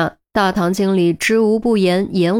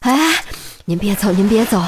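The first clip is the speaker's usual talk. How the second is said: urgent. Acted. Manager stops her leaving.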